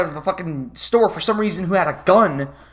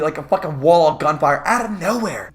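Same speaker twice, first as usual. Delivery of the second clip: normal talking voice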